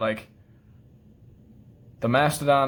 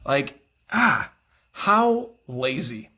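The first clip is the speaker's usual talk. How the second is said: disgusted; much slower than usual. Real, not acted. Ranting about a lazy knife release.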